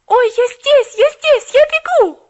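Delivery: put-on girl calling down the stairs in joy; joyful cry. A narrator's reading. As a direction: call out with delight. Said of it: "С радостным возгласом".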